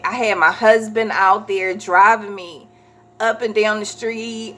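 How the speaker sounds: normal talking voice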